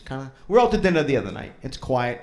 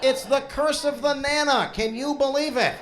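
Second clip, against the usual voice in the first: higher, hammed-up ballgame radio call; mock radio broadcaster joking that mom jinxes the Sox; mock sports announcer; 6 semitones higher than usual. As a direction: call it like a play-by-play announcer over the crowd.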